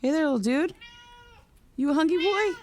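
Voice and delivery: high, sing-song voice for a small animal; talking sweetly to a hungry pet. Acted. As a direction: coo at the little dude like a pet owner.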